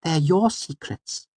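high, slow, patient explaining; Auri. A narrator's reading. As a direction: explain it slowly as if to a child. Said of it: "as if explaining something to a child".

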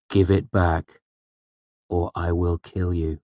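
level, deadly serious threat; controlled, measured menace; earnest threat. A narrator's reading. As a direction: threaten without raising the pitch. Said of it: "I meant it in perfect earnest".

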